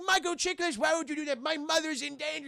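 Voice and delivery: high-pitched, distressed mock scolding; hammed-up high indignation; upset hero. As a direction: protest in a high, pleading hero voice.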